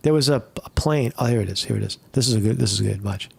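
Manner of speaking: normal talking voice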